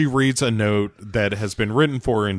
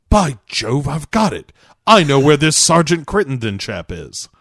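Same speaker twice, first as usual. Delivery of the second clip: higher, louder hammed-up Cockney; mock Cockney accent; 5 semitones higher, 6 dB louder than usual. Chester waking with the answer, exclaiming in mock Cockney. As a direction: put on a jolly bad Cockney accent. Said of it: "I've somehow woken up with a Cockney accent".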